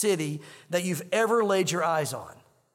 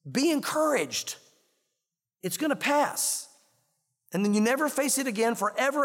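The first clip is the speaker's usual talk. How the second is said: reassuring; 5 semitones higher than usual. Real, not acted. Pastor comforting congregation.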